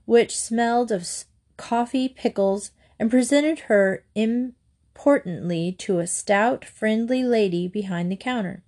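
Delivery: usual speaking voice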